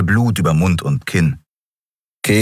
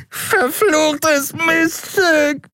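very high, very slow howled curse; howling curse; much slower than usual. A narrator's reading. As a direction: drag it out in a pained wail. Dealer curses after broken nose.

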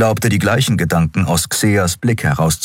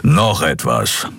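grumbling; 2 semitones lower, slower than usual. Acted. Skrier adding a condition, slow grumble. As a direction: grumble it out slowly. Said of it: "brummte er".

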